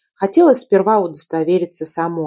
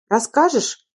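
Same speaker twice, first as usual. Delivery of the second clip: higher-pitched, anxious question; a touch of worry, pitch lifting; 7 semitones higher than usual. A narrator's reading. Husband prompts his nodding wife to tell him, anxious. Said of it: "В его голосе уже слышалось волнение".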